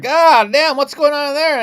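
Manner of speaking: high, loud cry of pleasure over food; a loud, high-pitched groan of food delight; loud eating exclamation. Retold or imitated. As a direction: belt it out like the food is amazing. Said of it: "you can hear me eat from the other room".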